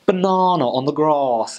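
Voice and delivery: mock posh Southern English, slow drawn-out A's; drawn-out posh southern English vowels; posh English accent. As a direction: draw out the A's like the Queen. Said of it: "like Queen's English".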